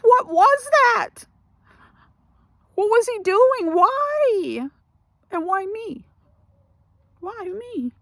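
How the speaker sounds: high, drawn-out distressed questioning; disgusted disbelief. Retold or imitated. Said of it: "I was disgusted. I was like".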